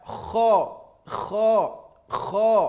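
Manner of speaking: KHA said as a strong, rounded "kho"; hard scraped KHA, rounded like "kho". Shown as a demonstration. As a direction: push a thick throaty KHA with an o colour.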